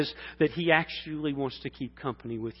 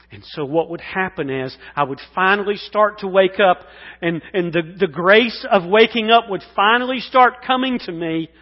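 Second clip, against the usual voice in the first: loud, rising sermon climb, much higher; full-force preaching; 8 semitones higher, 15 dB louder than usual. Acted. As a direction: preach it out to the crowd, building.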